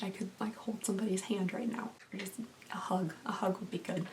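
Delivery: small, needy whisper. Real, not acted. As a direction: whisper it like you need comfort. Wishes for a hug, overwhelmed.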